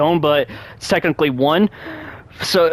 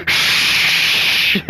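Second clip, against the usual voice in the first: whispered rain noise; rain hiss imitation. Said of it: "and then it goes".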